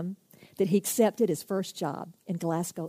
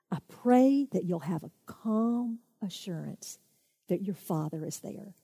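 ordinary talk

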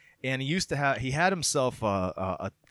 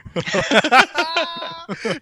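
loud high laugh; 10 dB louder than usual. Real, not acted. Laughs at new era joke.

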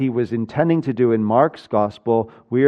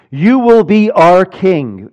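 loud, drawn-out declaration, pitched higher; forceful proclamation; 5 semitones higher, 6 dB louder, slower than usual. Acted. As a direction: proclaim it slowly like an insisting crowd. Crowd forcing kingship on Jesus.

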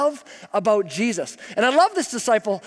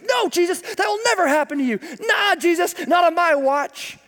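high, protesting rebuke; mock protest; 7 semitones higher than usual. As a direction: brush off the idea, playing hero. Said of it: "starts to rebuke Jesus".

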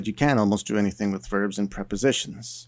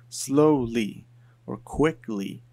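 drawn out, slow; slow demonstration read; slowed demo; 3 semitones higher, slower than usual. Shown as a demonstration. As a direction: stretch "slowly or quickly" out at a slow pace. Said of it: "How fast am I talking to you".

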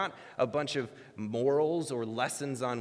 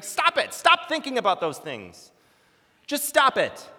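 very loud, high-pitched commands; exasperated yelling; 12 semitones higher, 9 dB louder than usual. Retold or imitated. Psychologist repeating his cure to anxious patient, yelling. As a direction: yell each order at the patient. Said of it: "he just yells".

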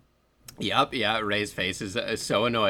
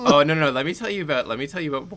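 louder and fast, bursting to tell; eager outburst; 5 semitones higher, 7 dB louder, much faster than usual. Real, not acted. Cutting in to tell friends more, loud and quick. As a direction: jump in eagerly, almost laughing. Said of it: "bursting in to rant".

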